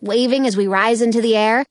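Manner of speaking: ordinary delivery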